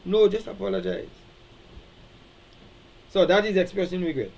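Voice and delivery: ordinary talk, nothing marked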